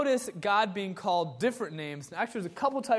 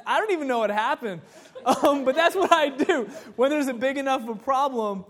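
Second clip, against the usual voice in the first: fast, louder, laughing through the words; 6 semitones higher, 7 dB louder than usual. Real, not acted. Pastor still laughing as he wraps up the van story. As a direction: still chuckling as he sums up.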